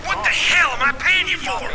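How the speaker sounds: angry shout. Acted. Commander berating soldiers.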